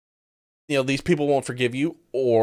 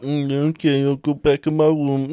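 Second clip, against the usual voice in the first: mock sulky voice. Mocking exchange, put-on voices.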